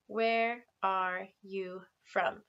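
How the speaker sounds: very slow, clear teacher modelling; slow model question. Shown as a demonstration. Teacher modelling a question slowly for students. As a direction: stretch each word for the class. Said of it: "say that with me".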